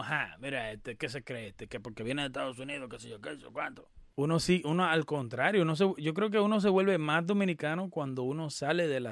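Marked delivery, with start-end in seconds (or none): 0.0-3.9 softer, lower mock scorn; imitated disdainful onlookers. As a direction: voice the disdainful onlookers under your breath. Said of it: "gente que te mira como".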